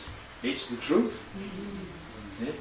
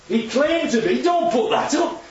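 indignant protest; 9 semitones higher than usual. Retold or imitated. Authorities protesting to Pilate.

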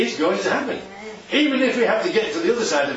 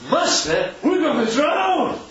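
panicked cry; 8 semitones higher, slower than usual. Retold or imitated. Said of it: "And they said".

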